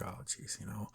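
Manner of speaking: very soft, low mock dismay; dismayed aside. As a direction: let a retold "oh no" sink almost to nothing. Retells seeing his brother, dismayed.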